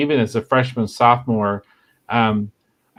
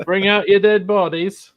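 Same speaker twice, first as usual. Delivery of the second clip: higher mock town crier; film quote; 8 semitones higher than usual. Shown as a demonstration. [name] calls out the film line in a higher mock voice. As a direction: call it out like a plague cart crier. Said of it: "There you go".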